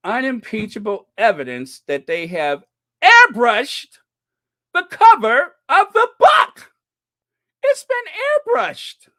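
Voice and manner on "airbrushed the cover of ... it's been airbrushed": very high, loud squeal of outrage; mock outrage. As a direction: shriek it in exaggerated indignation. Mock outrage over airbrushing evidence.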